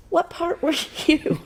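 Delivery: high, laughing retold question; retelling receptionist's baffled question.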